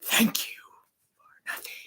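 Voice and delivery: hushed mock thanks; sarcastic whisper. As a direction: imitate a villain whispering a jibe. Imitating Robotnik's thanks to his agent.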